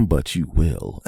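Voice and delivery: slow laughing warning. Real, not acted. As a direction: chuckle through a low warning. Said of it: "laughing warning".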